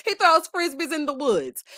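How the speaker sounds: laughing. Real, not acted. Laughing about disc golf.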